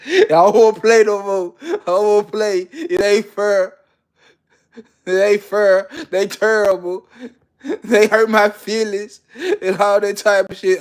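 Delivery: high, loud mock whining; whiny sore loser. Mocking the losing gambler, high whiny complaint. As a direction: whimper it like a sulking kid.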